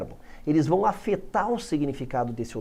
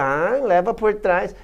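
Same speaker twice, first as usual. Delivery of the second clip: mock innuendo; 2 semitones higher, slower than usual. Mimicking a joking friend.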